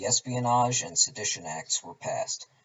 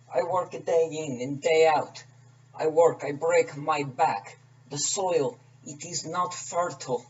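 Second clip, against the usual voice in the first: put-on higher, begging peasant in a Russian accent; pleading Russian accent; 4 semitones higher, 3 dB louder than usual. Peasant begging the Tsar for mercy, pleading in a Russian accent. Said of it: "along with me doing accents".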